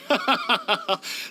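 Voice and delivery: a light, high laugh, brushing it off; embarrassed laugh. Real, not acted. Modest laugh at his own fame. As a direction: laugh off the compliment.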